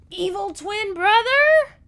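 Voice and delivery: high loud slow outcry; shocked gasp. Real, not acted. Vlogger reacting to the evil twin twist, shocked high outcry. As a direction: cry out in disbelief.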